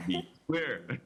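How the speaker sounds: be clear in a light Jamaican accent; a quick laughing Jamaican accent. Shown as a demonstration. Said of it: "jump into my Jamaican accent for a second".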